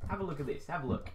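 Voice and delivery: plain reading voice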